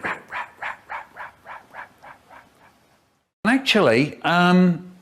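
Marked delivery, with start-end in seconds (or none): 0.0-2.5 fading echo. Acted.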